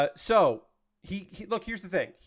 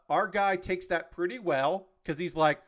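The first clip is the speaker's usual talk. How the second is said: ordinary talk, nothing marked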